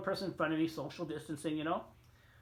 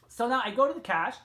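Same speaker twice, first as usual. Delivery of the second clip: usual speaking voice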